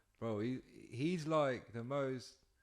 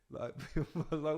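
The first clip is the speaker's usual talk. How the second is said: normal talking voice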